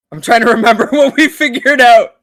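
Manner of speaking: high, loud, fast laughing words. Real, not acted. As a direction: talk through giggles. Player laughing mid-answer.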